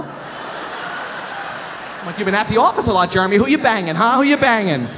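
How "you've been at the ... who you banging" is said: loud, higher-pitched comic grilling; mock accusation. Comedian grilling his neighbor about an affair, loud mock accusation. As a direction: hammer him with nosy mock accusations.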